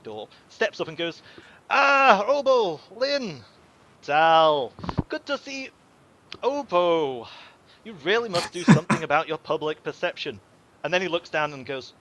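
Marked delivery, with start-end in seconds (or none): 1.7-10.4 high, showy merchant welcome; smug merchant greeting. Acted. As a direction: greet them like an oily shopkeeper. GM voices smug merchant Zhao Di.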